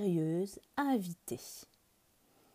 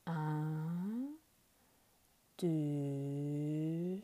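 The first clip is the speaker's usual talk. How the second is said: sleepy counting; 3 semitones lower, 7 dB quieter, much slower than usual. Acted. Rabbit counts falling asleep.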